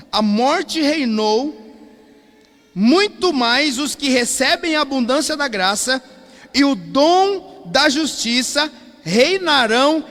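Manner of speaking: loud, slow, drawn-out scripture reading; emphatic scripture reading. Real, not acted. Pastor reads Romans to the congregation, emphatic proclamation. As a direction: proclaim the verse, stretching the key words.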